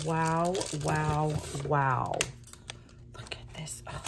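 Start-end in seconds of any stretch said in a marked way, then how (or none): 3.2-4.0 hushed whisper. Real, not acted. Showing the rosettes, whispering in awe.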